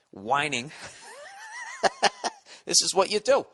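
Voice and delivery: normal talking voice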